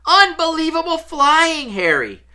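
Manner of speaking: high, loud, thrilled cheer; excited praise. A narrator's reading. Teammate congratulating Harry on his flying, high excited shout. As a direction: burst out with delighted congratulations.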